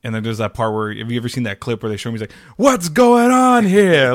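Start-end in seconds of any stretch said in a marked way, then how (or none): 2.6-4.1 much higher, louder, very slow coach bark; Vince Lombardi impression. Host imitating Vince Lombardi from a clip, booming coach voice. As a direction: bellow it like an old-school football coach. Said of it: "there's a clip of him".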